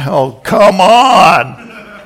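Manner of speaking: slow, loud, high laughing groan; laughing protest. Real, not acted. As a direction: drag it out while laughing. Laughing at his own story.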